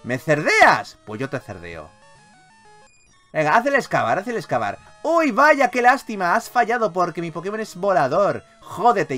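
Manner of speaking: ordinary talk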